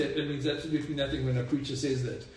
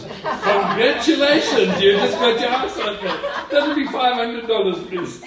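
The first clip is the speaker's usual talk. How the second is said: laughing punchline; 7 semitones higher, 8 dB louder than usual. Acted.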